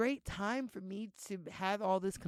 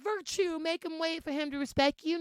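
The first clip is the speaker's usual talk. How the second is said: mock advice giver; 8 semitones higher, 7 dB louder than usual. Quoting people's old advice.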